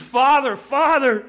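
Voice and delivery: anguished, high-pitched cry of prayer. Acted. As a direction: cry out to a father, begging for some other way. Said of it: "It's Jesus crying out".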